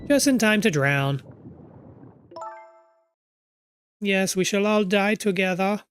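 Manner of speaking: mock doom. Mock doom about drowning.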